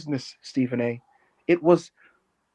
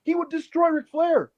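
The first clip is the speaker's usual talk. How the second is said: much higher earnest debater; pitched-up mock of a calm analyst; J J Redick arguing; 7 semitones higher than usual. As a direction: argue like a TV panelist, pitched up. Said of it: "going like".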